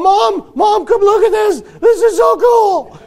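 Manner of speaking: put-on excited boy shout. Boy swinging from a tree yells to his mom, excited. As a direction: shout for Mom like a thrilled kid. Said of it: "He's going".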